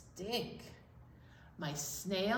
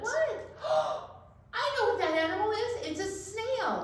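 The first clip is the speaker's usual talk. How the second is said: high, fast gasping realization; hammed-up eureka for the children; surprised discovery; 12 semitones higher, faster than usual. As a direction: gasp and blurt the answer up high. Mock discovery for the kids.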